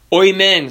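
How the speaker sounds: "oimen" with OY as first syllable; variant pronunciation. Shown as a demonstration. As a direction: start "oimen" like "oy". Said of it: "there's gonna be slight variations".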